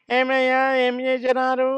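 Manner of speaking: high, loud mimicked teacher voice; a schoolboy's exaggerated teacher imitation; teacher impression. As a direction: pitch it way up like a fussy old teacher. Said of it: "అని ఒక ఆయన మాట్లాడేవాడు".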